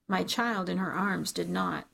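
ordinary delivery, nothing marked

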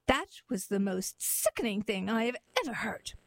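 ordinary delivery

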